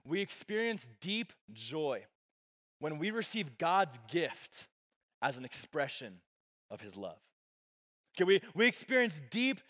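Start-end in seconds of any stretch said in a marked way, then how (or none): 0.1-7.2 slow and deliberate. Shown as a demonstration. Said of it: "I'll say it like this".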